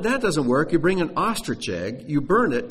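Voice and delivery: ordinary talk, nothing marked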